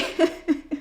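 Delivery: short high laugh; a scoffing little laugh; disbelieving laugh. Real, not acted. Her reaction in story.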